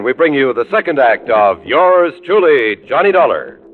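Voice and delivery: ordinary talk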